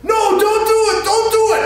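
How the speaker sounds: high, loud frightened cry; panicked pleading. Retold or imitated. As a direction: beg him to stop, voice way up high. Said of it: "she's going".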